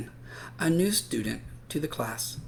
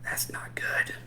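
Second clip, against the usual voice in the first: unvoiced sad whisper; whispered aside; 6 dB quieter than usual. Reader whispering to children that this is sad. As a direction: whisper it to the kids. Aside about Brian left out.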